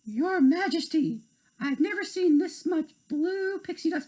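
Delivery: high, awed exclamation; amazed minister. Acted. Minister of Autumn to the queen, high amazed voice. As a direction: marvel at the dust. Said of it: "The Minister of Autumn gasped".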